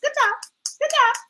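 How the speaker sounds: high, sing-song patting praise; patronizing daughter. Retold or imitated. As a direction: praise her like a pet, high and sweet. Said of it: "She was just".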